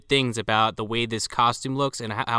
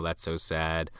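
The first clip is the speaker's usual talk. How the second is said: feigned sympathy; 7 semitones lower, 8 dB quieter, slower than usual. Retold or imitated. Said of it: "he just kinda plays along".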